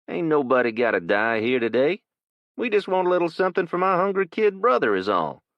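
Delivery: put-on a young man making demands; young thug. Young intruder bargains.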